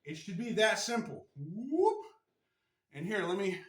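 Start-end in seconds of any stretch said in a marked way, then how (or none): 1.4-2.0 playful whoop. Acted. Showing viewers the hip roll, a playful high whoop. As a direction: let out a bright whoop of movement.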